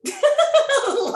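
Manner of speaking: a high burst of laughter; sudden high giggle. Real, not acted. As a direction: laugh out in giddy disbelief. Laughs at agreeing to compete.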